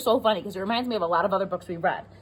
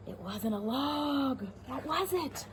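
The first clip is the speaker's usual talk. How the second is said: hushed suspense; 5 semitones higher, 10 dB quieter, slower than usual. Acted. Suspense in story.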